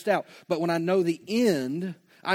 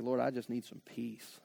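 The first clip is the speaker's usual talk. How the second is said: soft weary plea; 6 semitones lower, 11 dB quieter than usual. Pretend. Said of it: "It's like".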